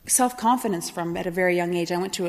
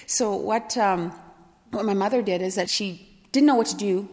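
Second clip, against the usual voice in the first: normal talking voice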